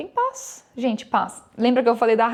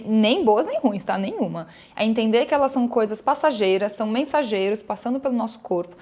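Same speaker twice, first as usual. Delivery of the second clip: ordinary talk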